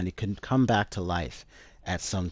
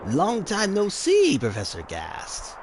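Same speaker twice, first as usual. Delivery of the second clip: slow, much higher sly villain voice; creepy scientist; 11 semitones higher, 5 dB louder, slower than usual. Acted. Hojo greeting Professor Gast, slow and sly. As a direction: drawl the greeting like a sneering villain.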